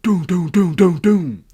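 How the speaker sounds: loud, high booming thuds; imitated door pounding. Mom pounds on the door, imitated banging. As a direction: hammer out each thud evenly. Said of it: "fucking pounding on the door".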